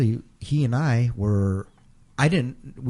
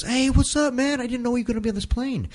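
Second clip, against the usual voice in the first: higher, delighted hey what's up; surprised greeting; 13 semitones higher than usual. Retold or imitated. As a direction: greet an old friend, pleasantly surprised. Eddie greeting him, surprised.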